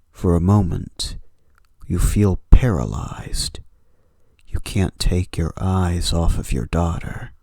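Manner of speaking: steady horror storytelling read; story narration. A narrator's reading. Narrator setting a late-night horror scene, measured story read. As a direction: draw the listener into the dark room.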